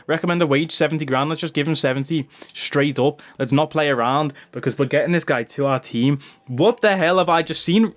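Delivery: usual speaking voice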